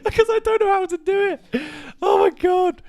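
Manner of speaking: very high squeal through laughter; helpless laughter. Real, not acted. Admitting failure to a friend, helpless laughter. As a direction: squeak it out while cracking up.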